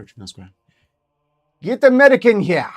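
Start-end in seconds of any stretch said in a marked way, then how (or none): 1.6-2.7 very loud urgent shout; calling for help. Acted. Staff calling medic for collapsed doctor, loud urgent shout. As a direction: yell for the medic.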